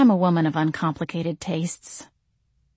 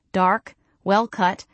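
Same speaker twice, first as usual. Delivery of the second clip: usual speaking voice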